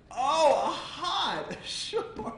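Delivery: high, amused, laughing at him; a Home Depot worker laughing at a customer's hut; mocking laugh. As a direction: scoff at the hut with a giggle. Salesman mocks the hut.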